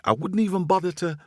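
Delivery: high, excitable squirrel voice; boastful squirrel. Acted. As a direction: play a cocky, hungry little squirrel.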